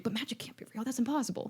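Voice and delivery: whispered, baffled denial; hushed mock disbelief. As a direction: whisper it as if unsettled. Retold family puzzling over magic.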